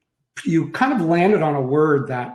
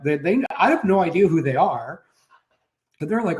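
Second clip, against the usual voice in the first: ordinary talk, nothing marked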